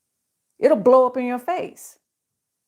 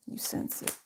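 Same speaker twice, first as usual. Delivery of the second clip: whisper; 14 dB quieter than usual. Shown as a demonstration. Repeats the phrase in a whisper.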